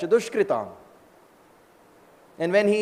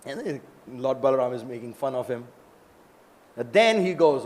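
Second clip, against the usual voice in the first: plain reading voice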